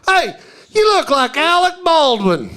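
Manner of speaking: high, drawn-out mock holler; calling out to a stranger. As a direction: hail him like an excited passer-by. Mimics a passer-by hailing him.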